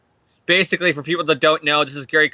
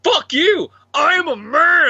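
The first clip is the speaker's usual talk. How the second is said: high, loud defiant shout; shouted stand against a ghost tormentor; defiant yell; 13 semitones higher, 5 dB louder, slower than usual. Retold or imitated. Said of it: "and he's like".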